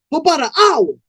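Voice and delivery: put-on high-pitched young dude's voice; young man answering. Young man answering Cold Blood's question, high-pitched. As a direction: answer up high, a bit defensive.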